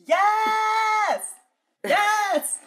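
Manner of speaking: high, drawn-out triumphant yell; big high-pitched cheer of "yes"; excited shout. Real, not acted. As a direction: shout yes from back off the mic. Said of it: "trying to get away from the microphone".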